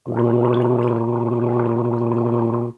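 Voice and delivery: throaty gargle with water; gargling water. Shown as a demonstration. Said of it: "I'll gurgle with it and see what sound".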